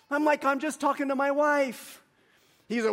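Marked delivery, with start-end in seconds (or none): 0.4-2.0 high, slightly raised plea of innocence; re-enacted indignant excuse; retold defensive protest. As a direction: protest to the man scolding you. Defends himself against the yelling man.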